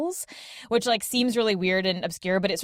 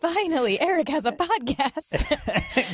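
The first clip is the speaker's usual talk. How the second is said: mock announcement; 8 semitones higher, slower than usual. Mocking joyful announcement.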